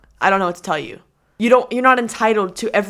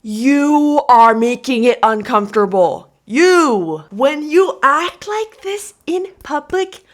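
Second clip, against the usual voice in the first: a slow, louder mock of the man's lecture; mocking the angry man; 4 semitones higher, 6 dB louder, much slower than usual. Vlogger mimics the shouting veteran at Sonic, mocking. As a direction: parrot his words back at him with scorn. Mocks the yelling man's words.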